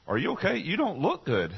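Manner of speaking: put-on high, small boy asking his mom; child's voice. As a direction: voice a worried little boy. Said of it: "He said".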